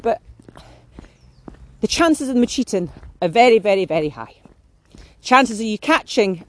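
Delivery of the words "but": mocking nitpicker. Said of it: "someone will go".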